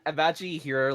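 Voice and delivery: normal talking voice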